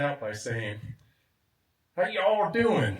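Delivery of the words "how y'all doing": drawn-out, higher, louder Chicago, Illinois greeting; slow, hearty Chicago, Illinois hello; Chicago accent. Retold or imitated. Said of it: "That was my best Chicago, Illinois accent".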